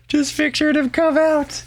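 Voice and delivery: very high squeak of amusement; cracking up. Real, not acted. Dad picturing his silly newborn Irishman, cracking up. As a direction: squeeze the words out while cracking up. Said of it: "it was making me crack up".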